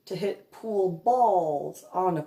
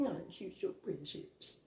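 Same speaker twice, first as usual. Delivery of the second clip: teasing coo; 2 semitones lower, 12 dB quieter than usual. Real, not acted. Teasing the pouty guy.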